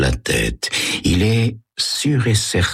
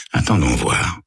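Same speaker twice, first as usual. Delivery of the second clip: plain reading voice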